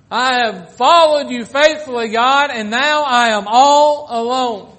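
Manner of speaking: loud, high voice of Elijah pleading with God; preacher voicing a despairing prophet; impassioned preaching. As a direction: cry out Elijah's lament from the pulpit. Preaching Elijah's lament at full force.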